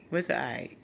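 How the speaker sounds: normal talking voice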